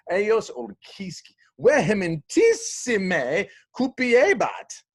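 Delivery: high, loud, slow declaiming; dramatic Latin reading. Acted. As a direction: play up the vengeful words. Dramatic Latin reading, vengeful line.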